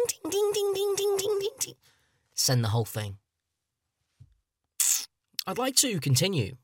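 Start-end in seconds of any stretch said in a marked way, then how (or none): 0.1-1.7 rapid high-pitched dinging; imitated phone alerts. Friend texts him in bits, imitating a barrage of phone dings. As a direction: mimic a phone pinging over and over. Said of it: "My phone's going ding".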